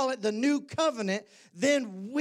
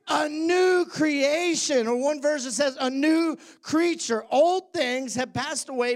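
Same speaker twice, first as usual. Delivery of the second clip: preaching; 3 semitones higher, 3 dB louder than usual. Acted. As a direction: proclaim it to the congregation. Preaching scripture at full force.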